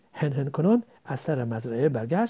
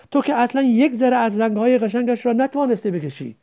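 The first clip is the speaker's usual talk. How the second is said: higher, louder complaint; upset reproach; 7 semitones higher, 5 dB louder than usual. Acted. Child faulting the drawing's colours, upset reproach. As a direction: throw the failure in their face.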